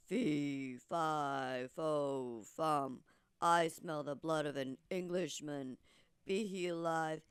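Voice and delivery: low, loud giant bellowing his chant; booming giant. Acted. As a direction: menace the whole kingdom, slow and heavy. Narrator voices the giant.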